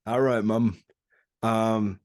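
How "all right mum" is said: echoing a mock northern English greeting; lightly imitated northern English accent. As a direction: copy the northern English lad. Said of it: "with his northern English accent".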